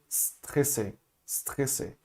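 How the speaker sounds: stressé, start on a bare S sound, no vowel; stressé with a clean S onset, said quietly; S straight into the word. Shown as a demonstration. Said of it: "fazer a boca do S e soltar o A".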